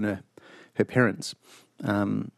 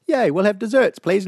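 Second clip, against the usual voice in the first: mock cheerful; 8 semitones higher, 3 dB louder than usual. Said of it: "we're like".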